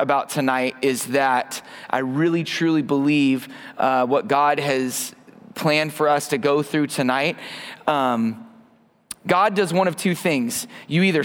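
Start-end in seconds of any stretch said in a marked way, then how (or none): none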